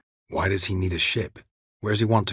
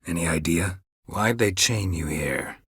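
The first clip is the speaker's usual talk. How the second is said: normal talking voice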